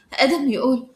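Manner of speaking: ordinary delivery, nothing marked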